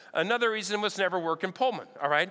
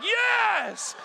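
much higher, louder shout of a thrilled crowd; 13 semitones higher, 4 dB louder than usual. Retold or imitated. Parade crowd begging for sodas, high excited shout. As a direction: yell it like a fan begging for a throw. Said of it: "they're like".

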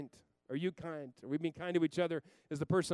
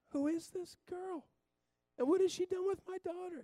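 mock amazement. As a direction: marvel like you can't believe it. Dad amazed at daughter.

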